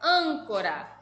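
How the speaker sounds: Âncora with a strong opening "an"; stress on first syllable. Shown as a demonstration. Teacher presenting the next example word, firmly stressed. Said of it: "a sílaba tônica é o an".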